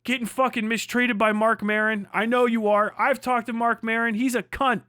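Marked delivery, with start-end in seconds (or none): none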